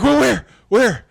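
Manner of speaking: high, loud demanding where; frantic searching. Retold or imitated. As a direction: look around desperately. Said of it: "I'm like".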